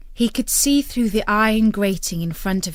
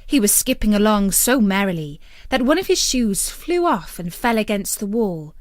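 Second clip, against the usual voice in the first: plain reading voice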